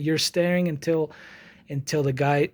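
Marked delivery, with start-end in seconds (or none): none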